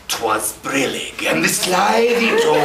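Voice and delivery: slow, hammed-up recital, pitch climbing; theatrical character reading, stretched out; storytelling voice. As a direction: overact a bedtime story grandly. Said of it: "reading the stories at night".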